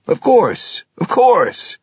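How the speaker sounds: an overeager clerk reassuring the buyer; eager salesman. Acted.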